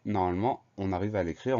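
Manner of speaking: ordinary talk, nothing marked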